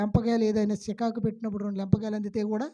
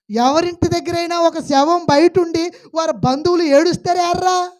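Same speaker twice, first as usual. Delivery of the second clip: loud, high preaching voice, pushing each phrase out; full-force preaching; 8 semitones higher, 8 dB louder than usual. Acted. Preacher booming at the congregation in full preaching force. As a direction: preach it hard to the pews.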